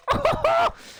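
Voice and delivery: loud, very high cackle; high-pitched giggly whoop; giddy high laugh. Real, not acted. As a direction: burst into a squeaky laugh. Giddy after excited outburst.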